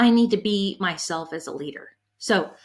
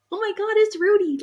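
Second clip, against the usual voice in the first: high, excited fangirl gasp; fangirl squeal; 11 semitones higher than usual. Retold or imitated. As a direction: squeal it like you've just spotted your idol. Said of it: "I'm kind of a fangirl".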